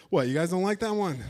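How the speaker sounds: normal talking voice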